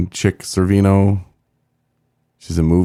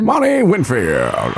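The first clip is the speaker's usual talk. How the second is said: high, slow announcer sliding into English; bad impression with an English-accent tinge; Vin Scully; 8 semitones higher, much slower than usual. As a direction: draw the name out like an announcer. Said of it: "An English accent?".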